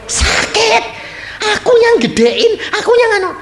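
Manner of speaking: high, tearful laughing complaint of a wounded mother; hurt parent. Acted. Preacher voicing a parent whose daughter married away, high tearful whine. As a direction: whine it out, half laughing half crying.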